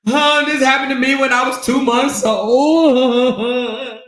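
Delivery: high, exaggerated fake wailing; mock crying. Mimicking a drunk woman's sob story, mock crying. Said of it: "she start crying and start talking about".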